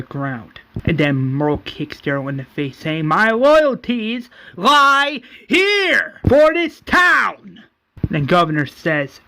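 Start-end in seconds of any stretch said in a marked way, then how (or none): 3.1-7.6 high, slow, loud declaration; shouted proclamation. Acted. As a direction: shout your loyalty out to the crowd. Acting Merle proclaiming loyalty to crowd.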